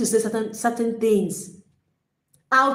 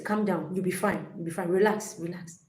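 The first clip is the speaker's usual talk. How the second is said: much softer, lower calming murmur fading out; soothing self-talk; 4 semitones lower, 10 dB quieter than usual. Shown as a demonstration. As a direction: hush yourself gently to settle down. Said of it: "you say".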